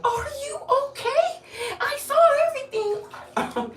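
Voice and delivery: lady rushing up, concerned and high-pitched; high mock lady voice. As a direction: imitate the worried lady way up high.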